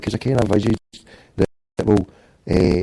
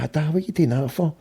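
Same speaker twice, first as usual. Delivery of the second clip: lightly imitated kid, higher and softer; retold worried objection, pitched up and quieter; child's protest to dad; 6 semitones higher, 5 dB quieter than usual. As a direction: voice a child pleading with Dad, softly. Child pleading with dad in story.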